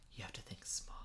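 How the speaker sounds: unvoiced whisper, dramatic. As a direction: hush it like a secret. Whispered movie quote.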